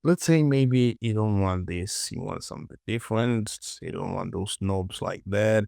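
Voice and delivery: normal talking voice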